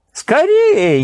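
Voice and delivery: sharp high impatient cry; impatient exclamation. A narrator's reading. Emir hurrying Nasreddin, impatient high cry. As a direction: snap it out, can't wait a second longer. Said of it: "нетерпеливо воскликнул эмир".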